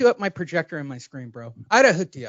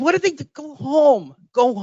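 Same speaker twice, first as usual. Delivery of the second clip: exasperated yell; 8 semitones higher than usual. Real, not acted. Yelling at beach crowd on video.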